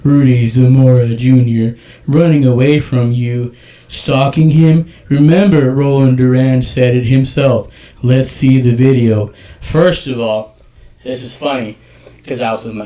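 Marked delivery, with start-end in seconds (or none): 0.0-9.3 slow, louder, deliberate mocking read. Shown as a demonstration. Reading the troll's message to the hosts, slow and louder. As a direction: lean on each word like reading to a child. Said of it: "I'm gonna read this in a slow voice".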